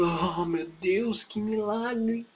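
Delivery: slow, drawn-out mock awe, soft and rising high; hammed-up amazement. Onlookers at the miracle, hammed-up slow amazement. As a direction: stretch it out in exaggerated hushed wonder. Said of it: "creio que eles ficaram muito".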